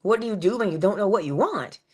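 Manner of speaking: ordinary talk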